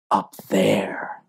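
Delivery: whisper. Acted. Whispered fear of the enemy.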